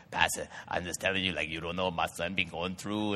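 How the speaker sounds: lightly put-on Cajun mama pleading with the pastor; imitating the mother. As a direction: voice her the way she really talks. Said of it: "And that's how they talk".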